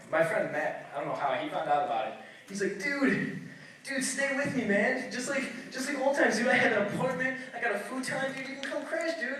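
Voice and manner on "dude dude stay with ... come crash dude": higher-pitched, casual dude voice; eager buddy. Retold or imitated. Friend Matt urges comedian to stay over, eager. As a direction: pitch it up, an enthusiastic invitation.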